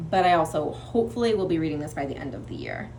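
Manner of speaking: ordinary delivery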